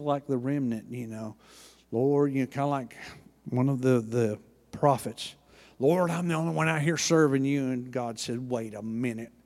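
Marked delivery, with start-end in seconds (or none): none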